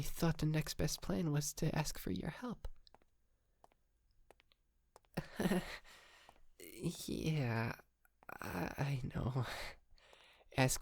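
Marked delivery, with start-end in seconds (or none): 5.1-5.8 short sheepish laugh; small unvoiced chuckle; nervous laugh. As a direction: laugh it off a little awkwardly. Admits plan to ask help.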